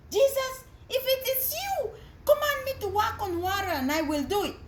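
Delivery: lightly voiced eager Peter; Peter asking. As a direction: ask boldly but hopefully.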